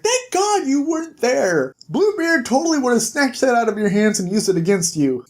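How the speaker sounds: high-pitched mock relief, then quick scolding; retold exasperation. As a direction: start high, then hurry through the reproach. Bigsby reproaches Snow in retold exchange.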